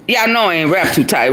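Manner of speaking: ordinary delivery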